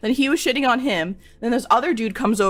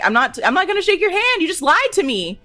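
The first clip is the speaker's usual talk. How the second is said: high-pitched indignant refusal; higher, louder, acted-out snippy comeback; bitchy; 8 semitones higher, 4 dB louder than usual. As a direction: refuse with retold bitchy attitude. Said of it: "I got really bitchy".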